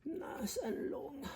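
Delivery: high squeaky stretched-out repeat; mock high, slow. Repeating to viewers about the car in a silly high, slow voice. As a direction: echo it in a silly high voice, slowly.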